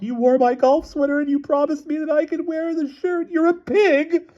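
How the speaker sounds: very high bawling wail; sobbing. A narrator's reading. Wife losing the shirt quarrel, sobbing and bawling. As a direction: wail the complaint, far up in pitch. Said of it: "began to bawl. She sobbed".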